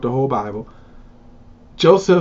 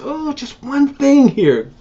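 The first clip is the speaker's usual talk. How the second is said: higher, slow sheepish son to his father; 10 semitones higher, slower than usual. Retold or imitated. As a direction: draw it out, nervously breaking news to Dad. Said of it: "He says".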